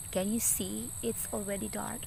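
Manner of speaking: usual speaking voice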